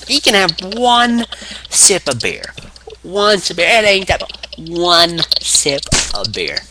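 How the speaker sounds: slow, high-pitched put-on wrestler voice; mock insistence. Acting Austin insisting on one sip.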